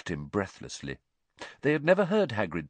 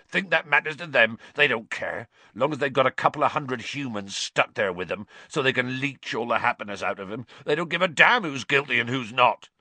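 Hagrid; 5 semitones higher than usual. A narrator's reading. Hagrid voiced in story.

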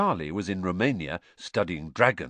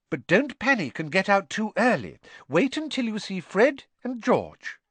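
higher anxious mother voice; higher worried mum; 12 semitones higher, 4 dB louder than usual. A narrator's reading. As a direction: urge him not to panic. Mrs Weasley warning.

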